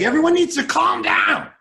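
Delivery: fed-up usher shouting at moviegoers; exasperated shout. Retold or imitated. Exasperated theater worker calling out.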